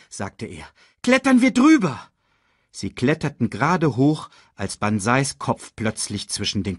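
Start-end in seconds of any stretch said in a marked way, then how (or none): 1.0-2.1 high, loud urging; sudden high-pitched command; urgent shout. A narrator's reading. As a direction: hurry them along in alarm. Cubs fleeing from hyenas.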